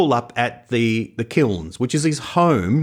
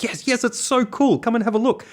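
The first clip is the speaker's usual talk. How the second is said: high-pitched, eager retold invitation; excited invitation; 10 semitones higher than usual. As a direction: wave someone in excitedly, voice lifted high. Retells his excited invitation inside.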